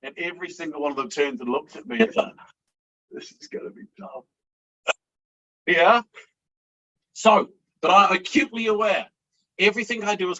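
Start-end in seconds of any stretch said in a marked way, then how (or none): 3.1-4.2 a hushed whisper to himself; whispered thought. Retold or imitated. Facing the traders staring, a whispered aside. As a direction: breathe it out as a private whisper. Facing a whole trading floor.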